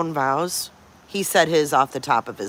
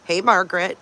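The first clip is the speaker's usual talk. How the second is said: normal talking voice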